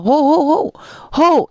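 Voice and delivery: frantic high cries; panicked yelling. Acted. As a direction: yell in a panic trying to stop the horse. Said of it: "nataranta siya ngayon".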